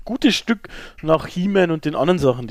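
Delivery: normal talking voice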